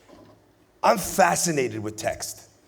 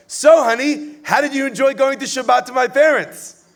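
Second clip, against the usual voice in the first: higher, louder sweet wife asking her husband; sweet young bride; 6 semitones higher, 5 dB louder than usual. Retold or imitated. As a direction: play the eager bride fishing for praise. Voicing the bride in joke.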